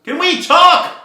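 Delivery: a high, loud call up to the birds; high yelled question. Acted. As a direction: shout it up into the treetops. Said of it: "Noel yelled".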